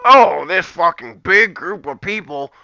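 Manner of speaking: sneering mock voice of the cancel crowd; a put-on voice mocking critics; mocking pretender. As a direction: parody the people he disagrees with. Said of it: "you're pretending".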